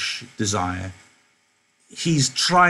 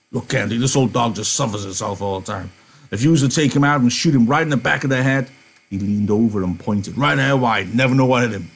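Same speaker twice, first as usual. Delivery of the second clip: loud disgusted Carlson; 2 semitones higher, 4 dB louder than usual. Acted. Carlson ordering Candy to remove the stinking dog, loud. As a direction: shove the complaint at him.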